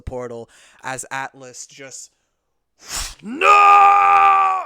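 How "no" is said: long, high full-force yell; falling cry. Acted. Atlas sucked into the portal, long loud scream. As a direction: scream it out as you're dragged away.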